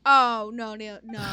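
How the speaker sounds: sudden loud shouted no; shocked shout. Real, not acted. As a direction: yell it in alarm. Streamer reacts in shock.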